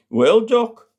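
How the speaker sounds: high, loud opening to the doctor; elderly Percy. Acted. Percy answering the doctor at the surgery, high voiced old man.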